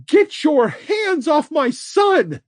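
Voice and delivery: high, loud, slow furious yell; furious shout. A narrator's reading. Mother yelling at the captor to release her son, furious shout. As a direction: scream it at the man holding your son.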